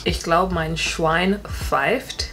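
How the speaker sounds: "Ich glaub, mein Schwein pfeift" slowly, word by word; a learner sounding it out; slow careful repeat. Shown as a demonstration. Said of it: "repeat after speaker".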